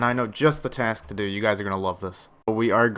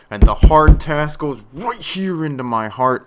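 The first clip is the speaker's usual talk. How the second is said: louder, mock-dramatic declaration; hammed-up delight; 6 semitones higher, 11 dB louder than usual. Real, not acted. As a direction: play it like a grand romantic line. Dramatic about the hard task.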